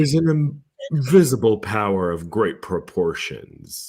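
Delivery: slow, weighty narrator impression; Morgan Freeman. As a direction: draw it out like a solemn narrator. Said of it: "Morgan Freeman would just be like".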